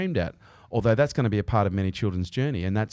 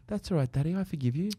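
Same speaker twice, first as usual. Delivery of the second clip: put-on child's voice; 6 semitones higher, 5 dB quieter than usual. Imitating his son forgiving him.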